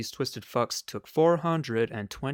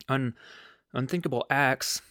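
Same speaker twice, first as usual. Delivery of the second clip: normal talking voice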